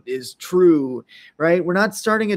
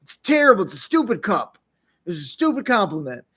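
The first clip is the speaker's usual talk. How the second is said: mock contempt; 3 semitones higher than usual. Mock rejecting the gift.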